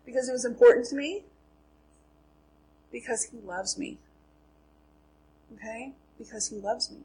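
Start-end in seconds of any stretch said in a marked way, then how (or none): 2.9-4.0 soft, hushed and sincere; tender. Real, not acted. Speaker telling the class why the letter mattered, tenderly. 6.2-7.0 very soft, gentle reassurance; tender. Real, not acted. Speaker letting the reason sink in with the class, tenderly.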